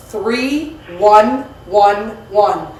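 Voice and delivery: very slow number by number; dictating. Real, not acted. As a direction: read each digit slowly. Dictating the address.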